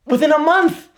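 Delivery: high, loud, furious exclamation; outraged cry. Acted. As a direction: explode with disgust. Hamlet erupting at the hasty marriage.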